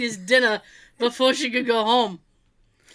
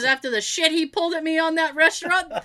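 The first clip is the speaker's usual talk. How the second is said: imitating mom's heated grievance; vengeful mom; 6 semitones higher, 4 dB louder than usual. Mother plotting revenge on her husband, mock indignant. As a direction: retell her payback vow, starting raised in pitch.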